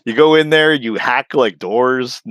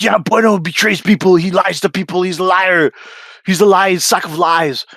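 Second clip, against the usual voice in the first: higher mocking whine; mock accusers; 6 semitones higher than usual. Mocking people calling Bueno a liar, higher mocking voice. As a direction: sneer out the accusation.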